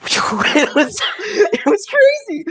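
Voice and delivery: high, slow, laughing through the words. Real, not acted. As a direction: laugh it out in disbelief. Recalling the wild ride.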